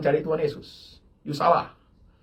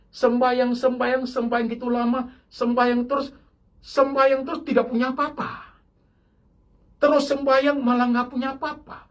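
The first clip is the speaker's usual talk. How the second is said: mock whiny complainer, pitched higher; complaining believer; 7 semitones higher than usual. Preacher mimics a believer who gave up, mock whiny grumble. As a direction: play the disgruntled believer grumbling at God. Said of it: "ngomongnya begini".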